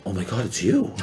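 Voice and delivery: soft parody of a fan, rising at the end; mock echo of awe. Survivor player echoing the starstruck woman, mock awe. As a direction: echo her awe back as a joke. His joking echo of her.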